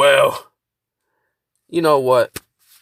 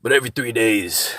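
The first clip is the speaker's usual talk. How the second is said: ordinary talk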